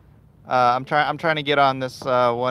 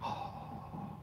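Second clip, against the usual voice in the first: awed whisper; 3 semitones higher, 14 dB quieter, slower than usual. Retold or imitated. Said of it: "he was just like".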